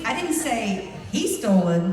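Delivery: he punched loud and drawn out; stress on he. Shown as a demonstration. Speaker showing how meaning changes, stress on he. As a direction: lean hard on he, hinting at someone. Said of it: "It changes".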